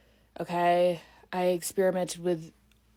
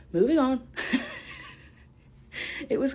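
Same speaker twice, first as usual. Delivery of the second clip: laughing; 6 semitones higher than usual. Real, not acted. Laughing at herself.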